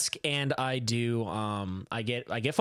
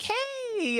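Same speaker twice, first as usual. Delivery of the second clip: high, chipper greeting; friendly caller. Retold or imitated. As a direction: imitate an over-friendly phone caller. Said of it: "she was so friendly".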